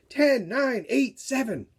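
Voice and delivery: high, loud party countdown; countdown shout. Acted. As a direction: call out the numbers like a crowd at midnight.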